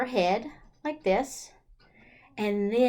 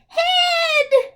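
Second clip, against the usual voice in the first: high, loud cry of the rhyme word; shout; 10 dB louder than usual. Acted. Teacher crying out the rhyme word for kids. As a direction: blurt the word out big and high.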